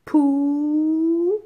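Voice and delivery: loud, emphatic dictation of pour; syllabified dictation. Shown as a demonstration. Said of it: "P, p, p".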